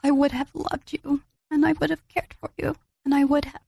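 put-on high, tearful lament to a lost baby; grieving words in a high, tearful voice; retold crying. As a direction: relive a night-time cry, pitched high and aching. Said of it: "crying and saying".

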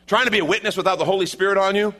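quick, loud and high insistence; fast emphatic burst. Acted. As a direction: rush it out with fire.

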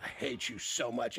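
low, slow, hushed mock hatred; quiet seething. Man to the gadget that didn't work, quiet mock loathing.

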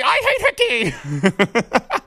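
slow, laughing through the words. Retold or imitated. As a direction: crack up while repeating it. Same words, repeated while laughing.

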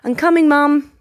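put-on high, bright kid answer; eager little boy. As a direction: chirp it back like an obedient child. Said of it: "he'll be like".

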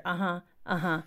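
absent uh-huh, trailing down; a husband half-listening at work; distracted husband. Guest mimicking her husband's distracted phone replies. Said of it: "the standard".

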